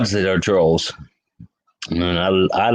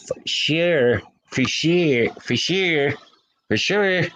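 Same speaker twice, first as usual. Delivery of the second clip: put-on higher, drawn-out valley girl for sure; valley girl attempt; 5 semitones higher, slower than usual. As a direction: try the Valley girl accent, rising higher each time. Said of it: "I cannot talk like a Valley person".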